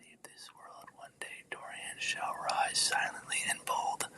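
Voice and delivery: very soft, near-whispered words; barely audible solemn whisper; hushed pledge. Real, not acted. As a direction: drop almost to nothing, as if to yourself. Hushed aside about Dorian's future.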